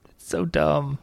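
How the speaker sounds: laughing under the breath; whispering. Real, not acted. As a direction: whisper it with a scoff.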